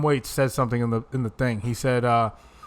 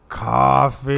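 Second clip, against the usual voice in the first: long drawn-out zombie word; slow mock-zombie call; zombie imitation; 4 dB louder than usual. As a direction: stretch it out like a craving zombie. Imitating the [name] zombie.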